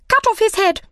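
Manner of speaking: very high, imperious queen's cry. A narrator's reading. Queen ordering an execution, crying out. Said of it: "cried the Queen".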